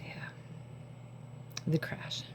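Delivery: soft unvoiced dread; hushed foreboding; ominous whisper. Real, not acted. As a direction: whisper it like a warning. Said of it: "ominous echo".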